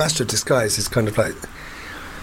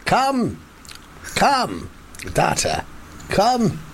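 mock starship captain; slower than usual. Captain summons the android officer to join him, mock commanding. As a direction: play-act a captain issuing commands.

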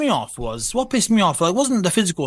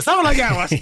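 laughing; 5 semitones higher, faster than usual. Real, not acted. Laughs at own story.

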